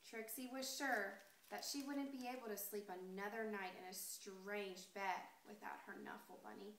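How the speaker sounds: ordinary delivery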